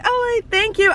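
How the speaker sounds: high-pitched gushing thanks; acted starstruck gratitude; fangirl thanks. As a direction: thank your idol in a retold squeal. Retold fan reply to compliment.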